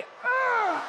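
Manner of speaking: high, drawn-out stretching groan. Shown as a demonstration. Speaker leading the room in a stretch, exaggerated groan. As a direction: let out your best morning stretch noise. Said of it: "give our best stretch noise".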